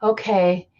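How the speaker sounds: mock exasperated sigh, grudgingly giving in; imitating a reluctant, put-upon official; eye-rolling. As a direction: sigh as if rolling your eyes. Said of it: "C D C rolling their eyes".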